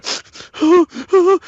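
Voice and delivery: a high startled intake of breath; sharp high gasp. Retold or imitated. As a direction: gasp as if shocked awake. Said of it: "all of a sudden I hear".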